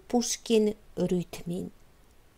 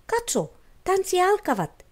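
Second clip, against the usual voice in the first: excited; 10 semitones higher than usual. A narrator's reading. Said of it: "kertoi hän jännitykset".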